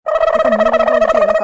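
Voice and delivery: loud, furious shouted retort; angry yell. Acted. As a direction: yell back at her in a rage. Driver yells back angrily. (கோபமாக உரத்த கத்தல்)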